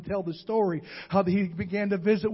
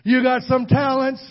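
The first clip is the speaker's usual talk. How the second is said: "You got some talents" said again much louder, leaning on You; emphatic repeat; 4 semitones higher, 7 dB louder than usual. Shown as a demonstration. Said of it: "look at your neighbor and tell him".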